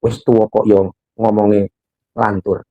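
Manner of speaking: low, slow, softened wifely grumble; grumbling wife. Acted. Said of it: "Nanti kan ibunya bilang".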